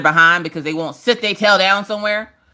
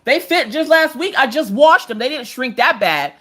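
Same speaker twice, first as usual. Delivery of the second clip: exasperated mom; 8 semitones higher than usual. Retold or imitated. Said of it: "I'm like".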